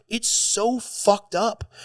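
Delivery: drawn-out disbelief at the cruelty; exasperated. Real, not acted. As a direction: let the outrage stretch out slowly. Outraged at cartel abuse.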